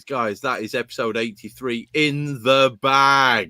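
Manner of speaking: drawn-out, deliberate build-up; slow, savoured announcement. Real, not acted. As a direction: lay it out slowly like a big reveal. Announcing episode wrapped up.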